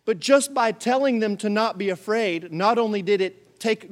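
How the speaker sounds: usual speaking voice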